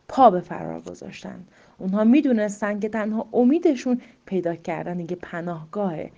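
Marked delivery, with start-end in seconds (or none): none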